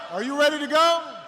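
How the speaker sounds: high, loud call to the staff; rallying shout. Retold or imitated. Candidate shouts loudly to his staff to pump them up. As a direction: pump up the team. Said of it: "I'd say".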